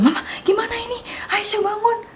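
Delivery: urgent hushed whisper to mom; panicked whisper. Retold or imitated. Panicked night whisper to her mother. (berbisik panik)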